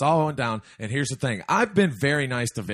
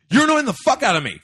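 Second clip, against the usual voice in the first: retold outburst, jumping high and louder; sudden angry snap; 9 semitones higher, 4 dB louder, faster than usual. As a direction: act out abruptly losing your patience. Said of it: "he shifts gears so fast".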